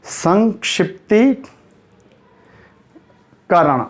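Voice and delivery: संक्षिप्तीकरण split by a long pause before करण; very slow dictation. Shown as a demonstration. Teacher waiting while students note it down, very slow. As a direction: dictate "sankshiptee", wait, then "karan". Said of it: "note करो फटाफट".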